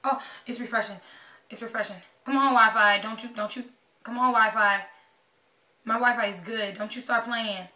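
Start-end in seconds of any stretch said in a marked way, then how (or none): 2.3-3.0 loud, insistent urging. Real, not acted. Fan urging the Wi-Fi to refresh, loud and insistent. 4.0-4.8 loud, insistent urging. Real, not acted. Fan urging the Wi-Fi to refresh, loud and insistent.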